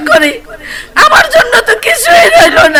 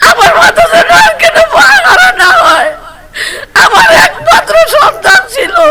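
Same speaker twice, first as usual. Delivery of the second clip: hit hard crying out; 9 semitones higher than usual. Real, not acted. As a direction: wail the demand through tears. Mother sobbing demands to government.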